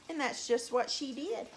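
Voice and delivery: ordinary delivery, nothing marked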